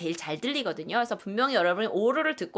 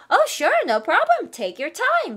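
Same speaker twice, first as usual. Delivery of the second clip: much higher, louder, slow sing-song waiter; cheerful waiter; 10 semitones higher, 6 dB louder, much slower than usual. Acted. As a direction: play an eager friendly waiter. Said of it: "그러면 보통". (친절한 웨이터가 밝게 대답하듯)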